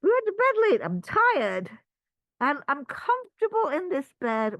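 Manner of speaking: high, loud, whiny complaint; sleepy protest. Pretend. Sleeper refusing the cat, high whiny protest. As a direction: whine like a tired kid in bed. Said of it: "we're like".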